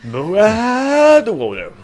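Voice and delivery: long, high, loud name call; drawn-out announcer call. Acted. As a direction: stretch the name like a ring announcer. Announcing next guest.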